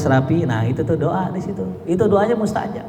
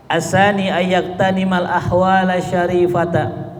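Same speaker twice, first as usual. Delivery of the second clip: normal talking voice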